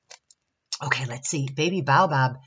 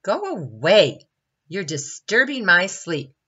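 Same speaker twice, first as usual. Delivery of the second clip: loud, raised-pitch protest; complaining; 4 semitones higher, 7 dB louder than usual. A narrator's reading. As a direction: complain loudly about lost sleep. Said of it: "he complained".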